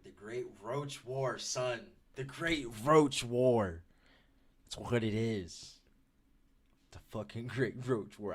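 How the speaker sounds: booming slow dramatic title call; frat bro. Pretend. Announcing the Great Roach War.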